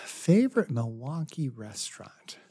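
whispered, thinking aloud; hushed, pondering whisper; musing to oneself. Real, not acted. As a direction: repeat the question under your breath. Musing over the host's question.

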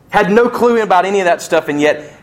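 high, driving sermon delivery; fervent preaching. Real, not acted. Preacher on Job seeing a Redeemer, fervent proclamation. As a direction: preach with rising fervour toward a shout. Preaches at rising full force.

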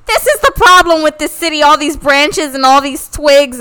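high, loud, preachy rant from a stranger; ranting guy. Retold or imitated. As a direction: play the street guy lecturing about the twigs. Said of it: "And he's like".

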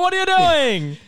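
mock shock. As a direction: pretend to be scandalized. Teasing mock outrage at cohost.

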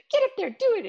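egging her on like a stage mom; pushy cheer. Retold or imitated. Mom pushing her shy daughter to sing, high excited cheer.

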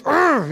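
high, loud mock groan of a sulky kid; kid's huffy groan. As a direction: groan like a kid forced into church clothes. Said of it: "We know this from little kids".

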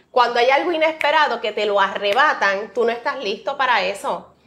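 ordinary delivery

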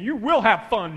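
loud, insistent mom forcing it; forceful mom. Retold or imitated. Mom to crying son at soccer, loud insistence. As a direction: bark it at a crying kid.

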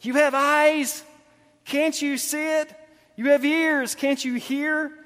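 high, drawn-out, incredulous questions; exasperated Jesus. Retold or imitated. Jesus to his uncomprehending disciples, acted exasperation. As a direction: spell it out slowly, acting at wits' end. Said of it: "wanting to pull his hair out".

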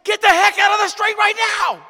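high, very loud yell; panicked parent shouting. Shown as a demonstration. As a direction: scream at the child to get off the road. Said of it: "What do you say?".

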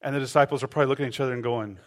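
ordinary delivery

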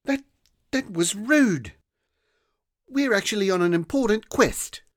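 put-on indignant girl. A narrator's reading. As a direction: scold like an indignant child. Rosa indignant.

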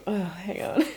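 ordinary talk

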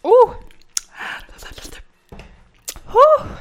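high piercing yelps from spice; surprised yelp. Real, not acted. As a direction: squeal as the mouth burns. Chili heat reaction.

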